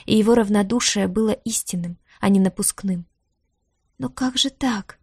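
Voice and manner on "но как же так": slow, higher, dismayed question; breathed out. A narrator's reading. Diara hears her brother is gone, breathed out in dismay. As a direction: exhale it in stunned disbelief. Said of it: "выдохнула она".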